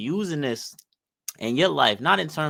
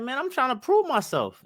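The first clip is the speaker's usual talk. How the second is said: imitating him in a much higher pitch; mock high voice; 13 semitones higher than usual. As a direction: quote the eager kid up high and light. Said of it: "he's like".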